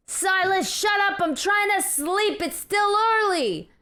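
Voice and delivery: high-pitched woken neighbour's shout; irritated yelling. Acted. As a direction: yell down from the window to silence him. Woken neighbours yell from windows.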